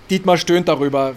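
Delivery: ordinary talk, nothing marked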